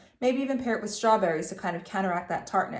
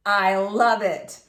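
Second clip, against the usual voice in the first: slow, loud proclamation; announced; 7 dB louder, much slower than usual. A narrator's reading. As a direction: announce it triumphantly, slowly. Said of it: "he announced".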